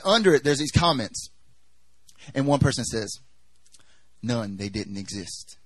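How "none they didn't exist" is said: low, quiet, slow readout; dismissive comment. Acted. Reads a dismissive online comment.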